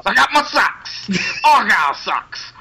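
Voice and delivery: high strutting promo with shrill woos; Edge doing Ric Flair. Retold or imitated. Friend shows his wrestler impression, high woos. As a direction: ham up the woo like a showman. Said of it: "I can do Edge doing Ric Flair".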